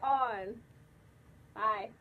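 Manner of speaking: muffled inside full mask head; through a costume head. Real, not acted. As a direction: voice inside enclosed headpiece. Seen 5: Costume head covers whole head during speech; removed by 10.6.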